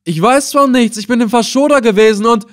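high, loud, hurried pleading; frantic protest. A narrator's reading. Old captive protesting he knows nothing, frantic. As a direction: protest fast at the top of the voice.

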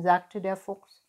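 ordinary delivery

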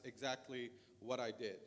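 ordinary talk, nothing marked

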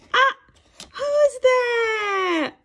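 high loud slow squeal of discovery; overjoyed read-aloud reveal; excited shout. Acted. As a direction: shriek it like the big find. Big reveal of Spot.

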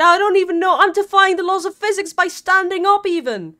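loud, high-pitched complaining; exasperated protest. Real, not acted. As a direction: protest loudly that you really did try. Exasperated protest to chat's advice.

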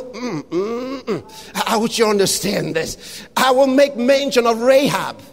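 ordinary talk, nothing marked

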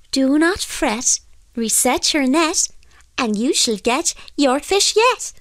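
high-pitched singsong little voice; little fairy voice. A narrator's reading. Fairy reassures the soaked fisherman, little high voice. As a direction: chant it in a small, high voice. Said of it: "a little voice which said".

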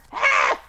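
high frightened shriek; startled scream. Real, not acted. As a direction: shriek as if ambushed. Mob behind him.

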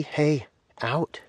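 high sharp shoo; shooing the dog. Real, not acted. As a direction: chase the dog off with a quick call. Shooing animals away.

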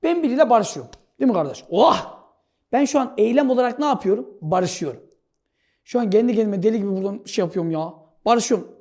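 ordinary delivery

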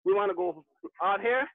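ordinary talk

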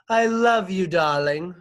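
high, loud gushing to a pet; over-the-top adoring coo at full volume; doting wife. A narrator's reading. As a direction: gush over your beloved cat, high and loud. Wife dotes on her cat.

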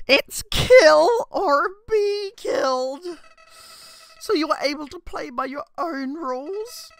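high, mocking, theatrical sneer; Flowey villain voice. Streamer reading Flowey's taunts to the player, high sneering villain voice. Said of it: "I can't do this voice".

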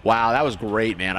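ordinary delivery

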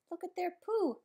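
ordinary delivery, nothing marked

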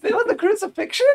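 high, fast mock woman's voice; mock shrill woman. Player joking in the halfling woman's high voice. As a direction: toss it off in her high voice.

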